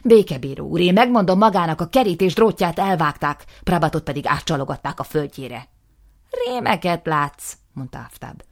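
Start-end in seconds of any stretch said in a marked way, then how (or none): 0.0-5.7 loud, furious outburst; angry accusation. Acted. Nisim accusing his brother, loud and furious. 6.4-7.5 slow, scornful brush-off; dismissive. Acted. Aftab dismissing his brother, slow and scornful.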